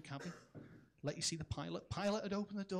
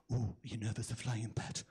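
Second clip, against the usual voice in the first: put-on light Geordie accent, lower; a kindly Geordie woman, lightly done, lower; 8 semitones lower than usual. Geordie neighbour to nervous flyer, a light Geordie accent, lower. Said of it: "a lovely little Geordie woman".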